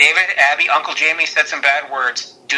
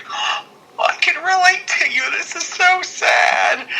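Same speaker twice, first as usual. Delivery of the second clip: very high, drawn-out mock weepy voice; hammed-up sympathy. Host relates to the friendless wrestler, hammed-up tearful sympathy. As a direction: wail along in put-on heartbreak.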